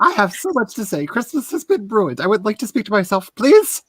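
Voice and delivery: fast, entitled Karen complaint. Acted. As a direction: demand it, huffy and pushy. Hostess plays Karen bit.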